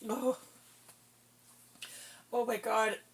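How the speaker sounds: ordinary delivery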